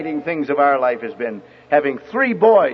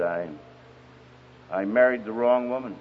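low, soft, halting admission; retold confession, heavy and subdued without crying; tearful confession; 9 semitones lower, 6 dB quieter than usual. Troubled man confessing to the preacher, quietly sorrowful. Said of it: "had tears in his eyes".

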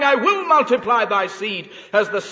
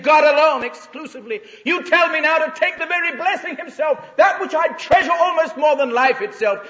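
Abraham's impassioned prayer, louder and higher; Abraham praying; 5 semitones higher, 6 dB louder than usual. Acted. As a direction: pour it out like a wrestling father before God. Preacher voices Abraham's prayer.

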